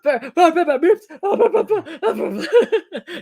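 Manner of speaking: put-on high, laughing drunk babble; drunk babbling. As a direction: babble like a drugged man. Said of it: "Drunk, drunk Lin Senior".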